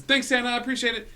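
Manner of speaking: put-on high, fast, excited little boy; a delighted kid thanking Santa; high child voice. Small boy thanking Santa, high and giddy.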